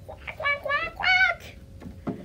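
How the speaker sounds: high, half-whispered puppet quacking; duck quacks. Pretend. Puppet duck promises to listen, high whispery quacks. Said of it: "you be good and be a good listener, okay?".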